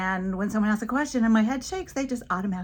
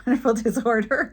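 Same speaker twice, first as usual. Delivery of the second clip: louder, breaking into a laugh; laughing irony; 4 semitones higher, 8 dB louder than usual. Real, not acted. Speaker jokes about her wonderful disorder, laughing. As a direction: laugh at your own sarcasm.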